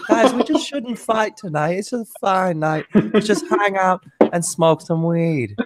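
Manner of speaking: high-pitched, drawling stoner character; Shushar. Acted. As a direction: play a peace-loving stoner up high. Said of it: "This is how Shushar talk".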